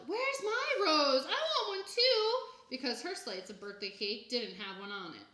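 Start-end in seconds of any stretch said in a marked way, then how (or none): 0.1-2.4 high, loud, slow demanding holler; drawn-out whiny yell. A narrator's reading. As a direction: holler it like a greedy toddler. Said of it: "Jenny hollered".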